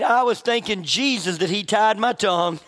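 usual speaking voice

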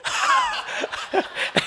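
a high, soft, airless laugh; breathless laugh. Real, not acted. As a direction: let out a soft voiceless laugh. Laughs at the joke.

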